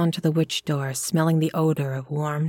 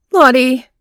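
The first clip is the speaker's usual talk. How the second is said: loud, higher call; 7 semitones higher, 11 dB louder than usual. A narrator's reading. Couple calling into the empty room, loud.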